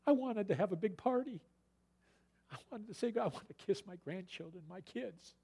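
a sugary put-on father voice; mock Laban. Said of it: "And now he comes and says".

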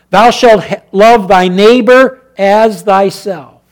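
loud, high preacher's cry; loud gospel preaching; fiery preaching. Acted. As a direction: proclaim the commands to the congregation. Preaching scripture commands.

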